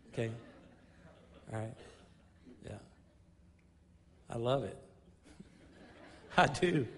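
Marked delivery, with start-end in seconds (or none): 5.6-6.9 laugh, then say it brightly lifted; amused laugh. Real, not acted. Teacher joking with church about students forced to attend, laughing. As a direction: chuckle into the words.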